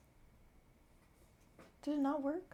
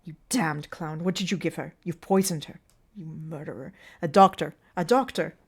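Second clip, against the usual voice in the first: furious hiss; 10 dB louder than usual. Acted. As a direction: spit the accusations at him. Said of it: "Mancini hisses".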